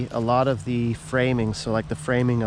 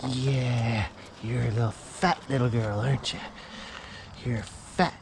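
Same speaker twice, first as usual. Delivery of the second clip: ordinary talk, nothing marked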